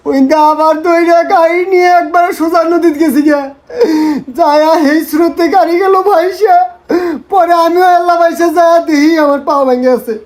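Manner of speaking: put-on wailing account; hammed-up tearful whine; fake sobbing. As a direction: sob between phrases for sympathy. Tearful sham account of crash.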